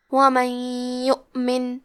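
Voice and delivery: وَمَن يُؤْمِن with the ن merged into ي with a nasal hum; idgham with ghunna. Shown as a demonstration. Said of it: "غنہ اور تشدید کے ساتھ پڑھیں گے". (وَمَن يُؤْمِن میں غنہ اور تشدید کے ساتھ)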